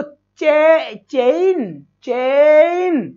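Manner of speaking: चेन stretched slowly as "cheeein"; slow teaching demo of चेन, long ei; long drawn-out vowel. Shown as a demonstration. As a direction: hold चे, then glide through i into n in चेन. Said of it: "लंबा खिंच रहा है".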